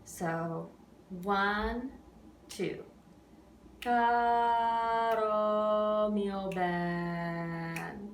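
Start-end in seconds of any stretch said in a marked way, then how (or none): 3.8-8.1 "Caro" held, then "mio ben" in strict time; spoken in rhythm. Shown as a demonstration. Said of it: "say it in rhythm first".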